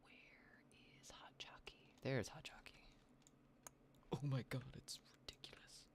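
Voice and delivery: hushed whisper near sleeping cat. Real, not acted.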